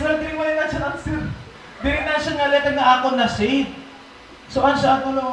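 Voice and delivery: a dawning oh-no moment; sudden panicked realization. Retold or imitated. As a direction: catch the mistake in alarm. Realizing the wrong shade.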